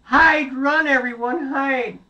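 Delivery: very high, panicked cries; frightened shouts. Acted. Goats cry out as the wolf gets in, very high and frightened. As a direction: scream for everyone to hide. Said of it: "they shouted and screamed".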